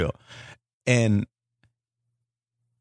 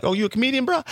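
high mimic of the promoter; 13 semitones higher, faster than usual. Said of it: "the promoter's like".

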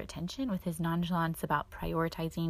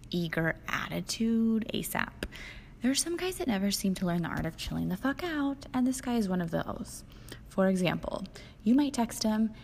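usual speaking voice

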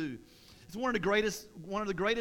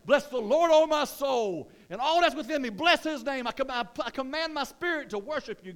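high, loud worshipful proclamation; exultant praise; 7 semitones higher, 7 dB louder than usual. Acted. David suddenly turning to worship God, exultant shout. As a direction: burst into praise at full voice. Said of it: "Then he's like".

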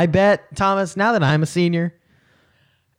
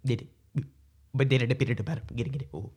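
softer sitcom-character patter; Kramer; 9 dB quieter, faster than usual. Acted. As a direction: do a light impression of a sitcom catchphrase. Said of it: "I'll do it front, full front".

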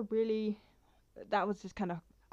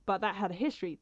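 normal talking voice

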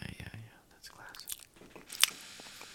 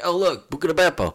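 mock Italian accent; 9 semitones higher, 5 dB louder than usual. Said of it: "I was like".

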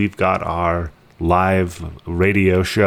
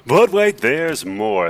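infomercial pitch; 6 semitones higher, slower than usual. Pretend. Mock infomercial twist on sign-off.

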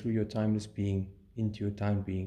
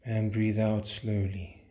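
slow, drawn-out calm instruction; slow breathing cue; slower than usual. Acted. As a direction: ease each word out slowly. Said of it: "breathe in slowly".

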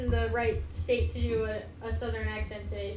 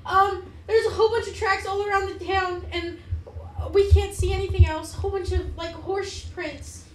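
higher-pitched, lively mayor voice; worried town mayor; 6 semitones higher, 4 dB louder than usual. Acted. Mayor tells the party children are missing, higher worried voice. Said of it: "she comes up and she goes".

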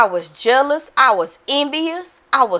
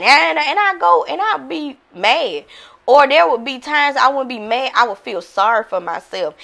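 ordinary talk, nothing marked

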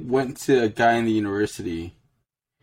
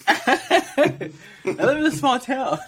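laughing; 14 semitones higher, much slower than usual. Real, not acted. Defending himself while laughing.

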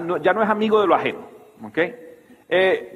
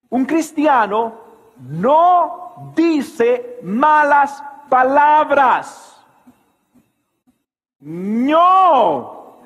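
higher, slow preaching at full force; 8 semitones higher, 4 dB louder, much slower than usual. Acted. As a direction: drive the point home from the pulpit.

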